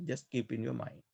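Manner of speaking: ordinary delivery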